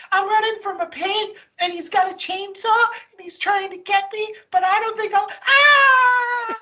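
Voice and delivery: high, breathless influencer ending in a scream; mock a panicked influencer filming herself. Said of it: "we get a fucking influencer with a phone".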